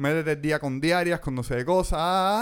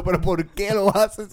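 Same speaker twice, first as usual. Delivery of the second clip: retold plea; 5 semitones higher, 4 dB louder, slower than usual. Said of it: "le respondo".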